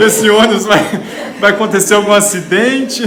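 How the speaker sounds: rindo ao contar. Real, not acted. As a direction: retell the worry through loud laughter. Conta a cena rindo alto. (contando entre risos)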